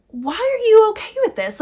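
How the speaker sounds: high, loud incredulous question; exasperated disbelief. Retold or imitated. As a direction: ask it as if you can't believe it. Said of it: "And I'm like".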